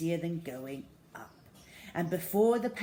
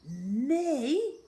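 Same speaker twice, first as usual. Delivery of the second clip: high, loud disbelieving "Me?"; can't believe she's asked; incredulous; 8 semitones higher, 7 dB louder than usual. Acted.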